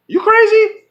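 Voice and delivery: incredulous. Real, not acted. Incredulous rant at the studio.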